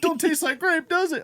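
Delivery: very high mock nurse voice; nurse's stock line. Nurse to the next patient, high mock patter. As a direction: imitate her in a high teasing squeal. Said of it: "she had the same line every time".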